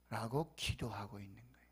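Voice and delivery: hushed unvoiced whisper. Real, not acted. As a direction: drop to a breathy whisper. Whispered aside after quote.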